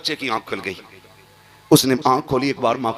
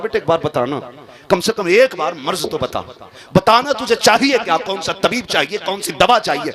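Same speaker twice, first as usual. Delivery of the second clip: rising, loud, desperate begging, much higher; a father crying out over his sick son, louder and louder; grieving father shouting higher and higher for a cure; pleading father's cry; 7 semitones higher, 4 dB louder than usual. Acted. Father begs sick son to speak.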